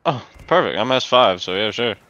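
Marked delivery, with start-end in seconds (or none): none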